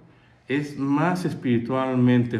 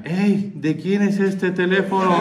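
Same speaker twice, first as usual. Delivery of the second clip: higher, louder call across the sauna; shout; 7 semitones higher, 4 dB louder than usual. Retold or imitated. Man in the sauna calling out to his friends, a loud call. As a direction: call out to the room. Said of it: "levanta la mano y grita".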